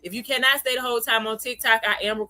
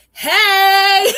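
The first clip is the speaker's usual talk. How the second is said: high, much louder greeting; loud cheerful hey; 9 semitones higher, 10 dB louder than usual. Retold or imitated. Little daughter loudly greets the elder man down the aisle. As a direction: call out a big bright hey. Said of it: "and she said".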